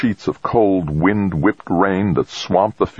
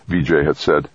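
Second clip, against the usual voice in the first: ordinary delivery, nothing marked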